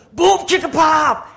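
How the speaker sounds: high, slow shouted chant; hyped-up pastor. Acted. Pastor leading kids in a chant, loud shout. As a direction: belt out the chant for repeating. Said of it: "He goes".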